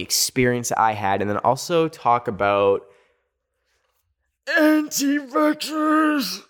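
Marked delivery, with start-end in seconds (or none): 4.6-6.4 high, slow, squeaky drawn-out word; mock squeal. Dreaded topic named in a mocking squeal.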